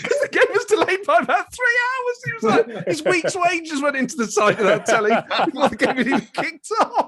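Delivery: laughing. Real, not acted. Funny memory of friend's dad.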